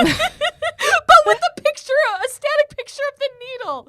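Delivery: high, squeaky laughing speech; helpless laughter. Real, not acted. Host cracking up over the broken election needle, laughing. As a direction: talk through a laughing fit, voice shooting up.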